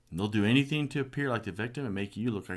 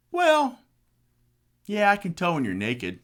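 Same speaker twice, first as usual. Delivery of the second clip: higher, louder mock wife voice; mimicking his wife; 12 semitones higher, 9 dB louder than usual. Ex-wife teasing him about gaining weight, high mock voice.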